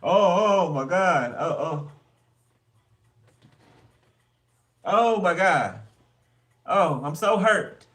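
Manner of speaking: loud high overblown wail, slow at the end; dramatic fake wailing. Reader mocking a drama queen, loud fake wailing. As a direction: overplay the hurt like a soap star. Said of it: "Somebody's dramatic".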